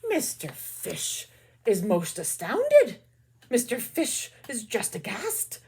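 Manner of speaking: storyteller hamming up the fish's shock; astounded narration. A narrator's reading. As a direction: read it with wide-eyed wonder.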